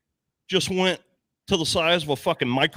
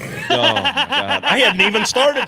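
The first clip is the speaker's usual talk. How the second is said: higher, slow laughing exclamation; 9 semitones higher, 6 dB louder, slower than usual. Real, not acted. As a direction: let it ride on a laugh, drawn out. Laughing at the memory.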